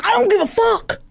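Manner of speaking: high-pitched defiant retort; hammed-up refusal to listen; mock angry dismissal. Gym member rejecting manager's apology, mock furious.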